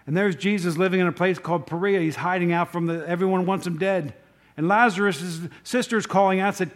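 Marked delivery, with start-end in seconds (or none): none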